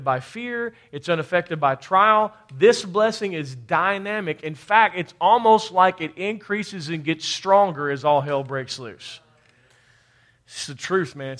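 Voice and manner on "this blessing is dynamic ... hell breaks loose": usual speaking voice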